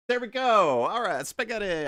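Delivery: high yell into the microphone; high excited yell into the mic. Real, not acted. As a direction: shout it out with glee. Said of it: "yelling into my microphone".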